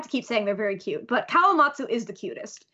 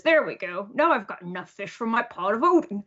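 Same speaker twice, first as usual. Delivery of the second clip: a hint of a lower man's voice; Oden's voice; 3 semitones lower than usual. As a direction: lightly voice a man pleased with his catch. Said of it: "And he says".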